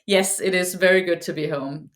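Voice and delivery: ordinary talk, nothing marked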